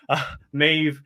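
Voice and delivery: normal talking voice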